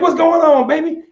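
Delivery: high, caring mother asking her son; mother's voice. Retold or imitated.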